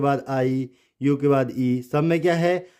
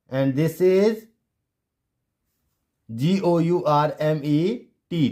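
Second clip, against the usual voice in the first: ordinary delivery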